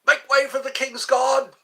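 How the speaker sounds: loud, high-pitched imitation of a sentry; guard's yell. Guards yelling at people who get too close, commanding shout. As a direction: shout them out of your way. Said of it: "they have to yell".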